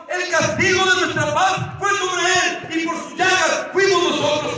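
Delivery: high, loud pulpit proclamation; fervent, forceful sermon delivery; impassioned preaching. Real, not acted. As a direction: drive each phrase home to the congregation. Preaches at full force to congregation.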